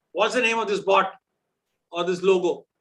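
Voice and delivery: normal talking voice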